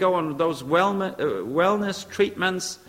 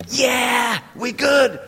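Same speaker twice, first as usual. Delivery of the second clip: high, loud "we good"; husband shouts his glad yes to his wife; joyful shout; 8 semitones higher, 5 dB louder, much slower than usual. Retold or imitated. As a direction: burst out happy and relieved. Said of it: "And then I say".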